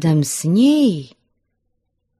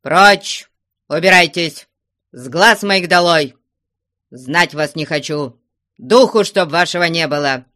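loud, menacing dismissal; thundering shouts; 2 semitones higher, 6 dB louder than usual. A narrator's reading. Father going through the ritual of rage, loud stern shouts. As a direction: threaten loudly but without real malice. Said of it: "Грозны и громки были крики его".

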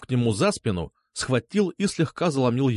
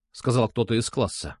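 ordinary delivery, nothing marked

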